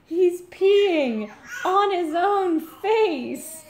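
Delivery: high, loud, drawn-out mock wail; imitating alarmed husband. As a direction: re-enact his cry from upstairs, stretching the words. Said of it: "And Nate just goes".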